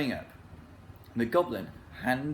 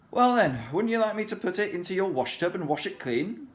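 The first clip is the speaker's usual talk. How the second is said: higher, louder, eager helpful offer; eager Saucepan; 8 semitones higher, 4 dB louder than usual. A narrator's reading. Saucepan offering to wash the lion clean, louder and eager. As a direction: offer cheerfully and loudly.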